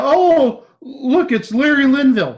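high, loud fake star-spotting gush; exaggerated, sing-out amazement; mock excitement. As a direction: pretend to be thrilled by a minor celebrity. Mock excitement at minor celebrity.

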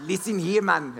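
imitating wife's South African scolding; mock-cross South African accent. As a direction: lightly put on South African telling-off. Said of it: "she'd fire off in South African".

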